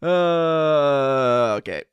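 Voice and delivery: ordinary talk, nothing marked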